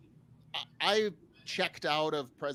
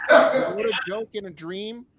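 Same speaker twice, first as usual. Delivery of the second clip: excited; 5 semitones higher, 12 dB louder than usual. Real, not acted. Excited retelling of dream joke.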